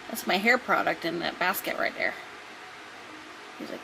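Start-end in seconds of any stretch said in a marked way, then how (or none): none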